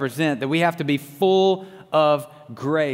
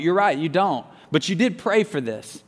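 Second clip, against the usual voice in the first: usual speaking voice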